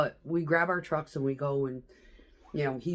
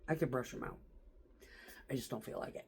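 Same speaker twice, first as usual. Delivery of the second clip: whispered aside; 4 semitones lower, 15 dB quieter, faster than usual. Real, not acted. Aside while showing his nails.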